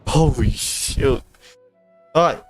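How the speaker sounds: ordinary talk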